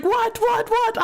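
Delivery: high-pitched startled questions; acted alarm. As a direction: imitate a mother jolted awake. Startled mother woken by screaming child.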